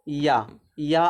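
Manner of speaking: या drawn out slowly, with a clear Y onset; sounded-out Y sound. Shown as a demonstration. Teacher showing the sound that takes article a, slow isolated या. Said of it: "या का sound आए".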